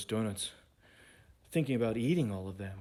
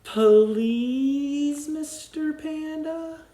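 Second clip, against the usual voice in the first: much higher sweet asking; polite tiny creature voice; 10 dB louder, much slower than usual. Acted. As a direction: ask it sweetly and high. Polite character asks.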